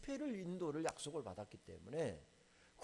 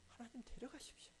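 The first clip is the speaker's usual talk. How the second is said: hushed plea; 7 semitones higher, 13 dB quieter than usual. Retold or imitated. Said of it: "분노가 일어나서".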